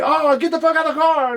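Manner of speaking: high, loud mock yell of the driver; retold yell. Driver ordering them out of the car, high loud mock yell. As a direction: bellow it like the angry driver throwing them out.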